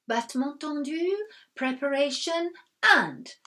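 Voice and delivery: ballet teacher counting. A narrator's reading. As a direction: call the steps crisply. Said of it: "her no-nonsense counting voice".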